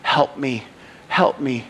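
low, soft, broken-off plea; crying out helplessly. Acted. Worn-out slaves crying out to God, hushed desperate plea. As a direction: plead quietly, with pauses between the pleas. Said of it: "All they did was just cry out".

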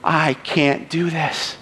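low, soft, broken-off plea; crying out helplessly. Acted. Worn-out slaves crying out to God, hushed desperate plea. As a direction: plead quietly, with pauses between the pleas. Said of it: "All they did was just cry out".

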